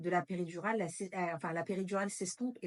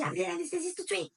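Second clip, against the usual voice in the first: urgent order; 10 semitones higher than usual. Retold or imitated. Chief doctor orders anesthetist urgently.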